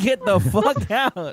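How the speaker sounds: high, slow mock yell through laughter; mock shouted order. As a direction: imitate cops kicking everyone out, laughing. Retells being thrown out at curfew.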